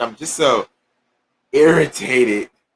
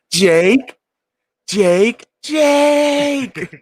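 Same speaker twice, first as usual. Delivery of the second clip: each Jake pitched higher, the last one high and drawn out; rising calls; 10 semitones higher, 5 dB louder, much slower than usual. Real, not acted. Host calls his friend's name, building up into a high stretched Jake. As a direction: call his name, climbing up.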